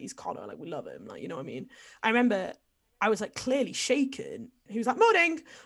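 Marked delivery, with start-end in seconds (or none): 5.0-5.4 high, loud cheery call; sing-out morning greeting; chirpy retold greeting. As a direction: imitate an oblivious flatmate's bright hello. Flatmate's oblivious greeting, acted.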